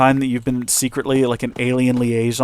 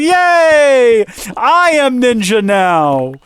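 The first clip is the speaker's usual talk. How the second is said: put-on high, slow, childish cheer; goofy hero; 9 semitones higher, 4 dB louder, much slower than usual. Hero thrilled by her offer, high slow goofy cheer. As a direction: cheer like a dopey kid. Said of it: "And you go".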